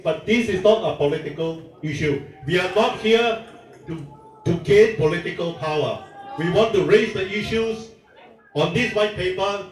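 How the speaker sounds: ordinary talk, nothing marked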